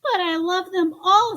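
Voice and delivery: high, loud protest; a child wailing at losing his toys; crying out. Acted. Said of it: "Spencer cried".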